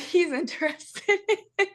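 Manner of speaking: ordinary talk, nothing marked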